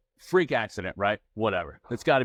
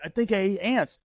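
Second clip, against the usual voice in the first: put-on higher voice of a worried child; imitated freak-out; 7 semitones higher than usual. Dad imitating his ten-year-old daughter, mildly freaking out. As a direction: imitate a rattled kid, stammering. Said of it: "she's freaking out kinda like".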